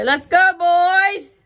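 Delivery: loud, high call to the dogs; rallying call from the porch door. Retold or imitated. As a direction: belt it out to bring the pack in. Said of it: "I have to say".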